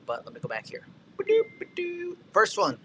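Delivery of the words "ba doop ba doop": playful sound effect. Acted. Scrolling back, playful sound effect.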